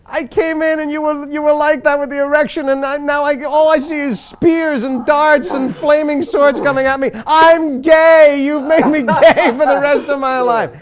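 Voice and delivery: high-pitched, loud traumatised wail; distraught roommate. Retold or imitated. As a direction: mimic a horrified friend building to an accusing cry. Acts out traumatised roommate's outburst.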